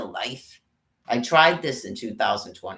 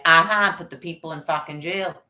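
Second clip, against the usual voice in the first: mock gleeful aha; 4 semitones higher, 4 dB louder than usual. Mocking officials gleefully jailing people.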